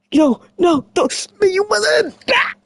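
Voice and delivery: high, frantic squeal grabbing a high heel; pleading in mock panic, then snap; panicked yelp. Character fending off a killer, high mock panic.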